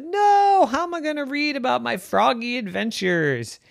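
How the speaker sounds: squeaky, pleading frog voice; high whiny frog. Acted. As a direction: whine it like a little frog. Gilbert protests losing glasses.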